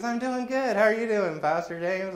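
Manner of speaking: imitated high, chirpy hello; cheery reply. Church lady greets her pastor back, high and cheery. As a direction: answer in an exaggerated high, friendly voice.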